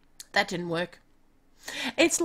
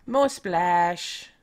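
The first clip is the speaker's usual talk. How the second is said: normal talking voice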